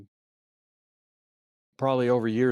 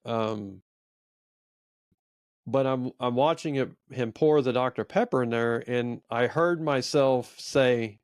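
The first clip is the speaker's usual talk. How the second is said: ordinary talk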